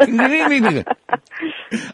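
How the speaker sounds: high-pitched garbled squeal; mock bitten-tongue noise. Shown as a demonstration. Telling co-hosts about biting his tongue mid-sentence, high mock squeal. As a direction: squeal as if your tongue is caught. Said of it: "I'm going, I'm".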